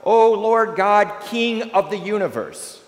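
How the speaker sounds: a mother protesting to God; crying out. A narrator's reading. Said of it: "The woman cries out".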